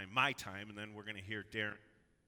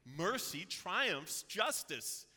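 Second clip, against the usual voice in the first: triumphant cry; 6 semitones higher, 7 dB louder, much slower than usual. Acted. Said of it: "so that I can cry out".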